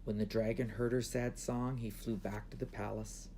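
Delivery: ordinary delivery, nothing marked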